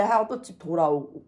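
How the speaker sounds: normal talking voice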